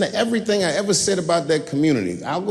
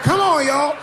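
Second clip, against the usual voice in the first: high rallying call; 11 semitones higher, slower than usual. Retold or imitated. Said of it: "I said".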